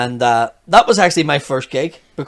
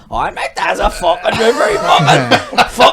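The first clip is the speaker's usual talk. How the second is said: loud, high, laughing site-worker rant; laughing through a mock tirade at the chapel women; mock builder rant; 8 semitones higher, 7 dB louder than usual. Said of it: "and I'd go and go".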